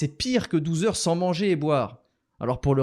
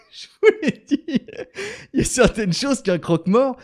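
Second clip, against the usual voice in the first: laughing; 9 semitones higher, 4 dB louder than usual. Acted. Sly laughing reply.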